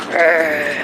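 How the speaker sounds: low soft growl; dog growl. Acted. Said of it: "Maggie gave a growl".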